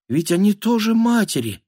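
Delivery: high, thin inner appeal; desperate thought. Acted. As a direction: think it as a last plea. Отчаянная мысль о толпе матерей. (отчаянная мысль тонким голосом)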